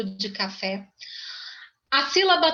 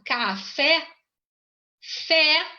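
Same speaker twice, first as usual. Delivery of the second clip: "café" split, then "fé" repeated alone, raised; syllable-counting demo; 4 semitones higher, much slower than usual. Shown as a demonstration. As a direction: say "café" in beats, then single out "fé". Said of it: "a palavra de trás pra frente".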